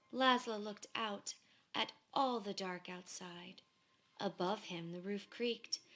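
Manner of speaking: ordinary delivery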